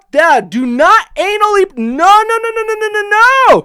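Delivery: hammed-up terrified no's; terrified pleading. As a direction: beg for mercy as the spike comes out. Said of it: "I am legitimately scared".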